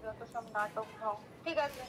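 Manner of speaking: faint, distant phone voice; grumbling over phone. Real, not acted. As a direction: grumble softly down the line. Friend grumbling on a phone call.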